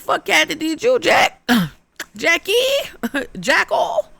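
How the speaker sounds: high-pitched disbelief while fumbling the name; shocked squeal. Real, not acted. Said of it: "shocked at the ghost's name".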